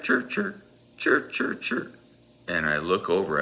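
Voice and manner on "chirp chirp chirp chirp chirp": high, unvoiced whistle-like chirps; much higher whistled chirp-chirp sounds; imitated whistle chirps. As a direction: mimic a ref blowing short chirps through his whistle. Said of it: "And I hear this chirp".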